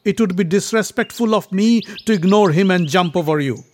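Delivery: declaiming prince. A narrator's reading. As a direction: proclaim it forcefully. Bhima declaims to the monkey.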